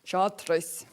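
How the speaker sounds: a slight Scottish accent on the word. Shown as a demonstration. Presenter shows Chris how they say chartreuse, Scottish accent. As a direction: put a touch of Scots on it. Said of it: "We always have to say it's Scottish".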